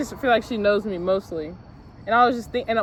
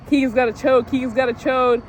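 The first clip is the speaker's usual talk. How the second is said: loud singsong mocking chant, raised pitch; retelling a classmate's loud teasing chant; schoolyard taunt; 4 semitones higher, 6 dB louder than usual. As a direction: taunt her like a jeering teenage boy. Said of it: "he was like".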